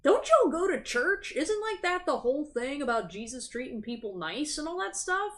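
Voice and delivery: incredulous question. Retold or imitated. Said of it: "and you're just like".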